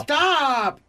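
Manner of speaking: high, drawn-out cry of protest; exasperated shout. Real, not acted. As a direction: shout it out to halt them. Host cuts into the argument.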